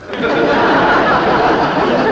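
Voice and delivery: breathy laughing fit; hearty laugh. Real, not acted. Missus Bixby laughing at her own forwardness.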